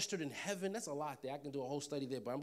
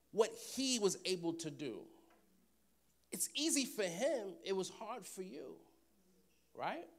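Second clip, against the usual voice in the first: ordinary talk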